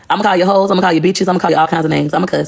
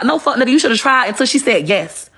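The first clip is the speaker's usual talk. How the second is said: ordinary talk, nothing marked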